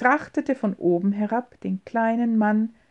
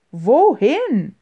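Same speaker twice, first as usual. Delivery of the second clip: louder called question; calling out; 4 semitones higher, 7 dB louder than usual. A narrator's reading. James calls out again while being dragged along. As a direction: call it out to him. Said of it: "rief James noch einmal".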